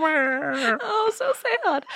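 high, hammed-up fake sob; fake crying on cue. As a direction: turn the tears on at will. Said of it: "that's what I do".

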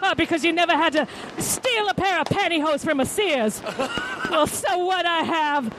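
high, hammed-up drunken mother pleading her case; yelling at the court. Mother on trial shouts at the jury to get her kids back, hammed up. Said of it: "She's yelling at the court".

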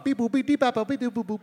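mock electronic beeps; synth noises. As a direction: hum quirky synth beeps. Imitating quirky synth soundtrack.

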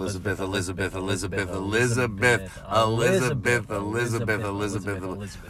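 low, run-together chanting of the name; rapid repetition. Pretend. Saying her name like thirty times, low obsessive looping. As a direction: babble the name over and over, fading away. Name repeated over and over on purpose.

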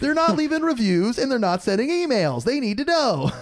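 high, insistent comic complaint; mock indignation. Host complaining about silent listeners, mock indignation. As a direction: pitch way up in playful protest.

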